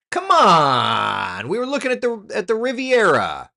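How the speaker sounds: coaxing a stuck map to load, frustrated whine; frustrated pleading. Real, not acted. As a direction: beg the computer to load. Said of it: "I'm frustrated".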